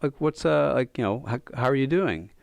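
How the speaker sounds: put-on old man cheerfully greeting the angry drunk; pleasant call. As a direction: greet him kindly, lifting the voice. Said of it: "he just calls out pleasantly".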